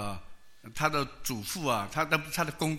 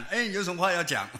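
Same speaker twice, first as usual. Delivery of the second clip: haughty official; 5 semitones higher than usual. Retold or imitated. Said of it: "他坐在高椅上跟他谈".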